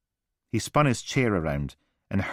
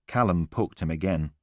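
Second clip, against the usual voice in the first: ordinary delivery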